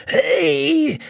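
hohes, gewürgtes Hey; röchel es mit Kette am Hals; röchelnd. A narrator's reading. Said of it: "röchelt er". (gewürgt röchelnd)

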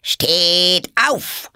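high, loud, penetrating command; piercing shout. Acted. Queen orders the gardeners up, piercing shout. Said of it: "schrie die Königin mit durchdringender Stimme".

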